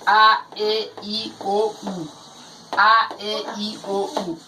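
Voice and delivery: A, E, I, O, U each held as a separate sound; vowels recited by name. Shown as a demonstration. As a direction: recite A, E, I, O, U the way most people read them. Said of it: "read these vowels as".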